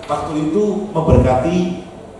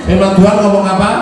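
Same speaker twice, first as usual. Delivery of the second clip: normal talking voice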